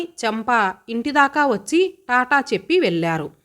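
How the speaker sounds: plain reading voice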